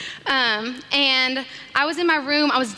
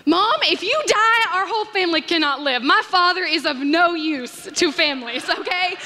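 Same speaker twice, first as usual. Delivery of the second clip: hammed-up plea; mock dramatic plea; 4 semitones higher than usual.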